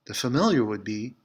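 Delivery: ordinary delivery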